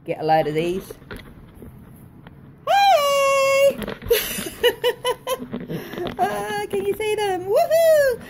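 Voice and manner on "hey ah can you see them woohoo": excited. Real, not acted. Showing off favourite slippers.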